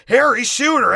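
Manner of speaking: loud, high command to a partner; urgent mock shout. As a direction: yell it up high, urging him on. Marv urging Harry in panic.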